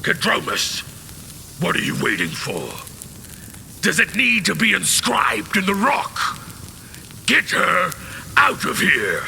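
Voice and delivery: high, fast, impatient commanding voice; high-pitched impatient leader yelling orders; urgent commander. Acted. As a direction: bark the order to get her away.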